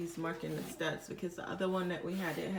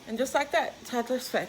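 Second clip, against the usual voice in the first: higher and louder, proud mini victory; triumphant announcement; 3 semitones higher, 5 dB louder than usual. Real, not acted. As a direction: announce it like a win.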